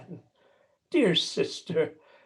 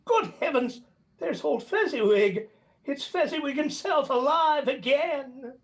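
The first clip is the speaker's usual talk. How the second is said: delighted surprise; 7 semitones higher than usual. Acted. Old man recognizing his old master.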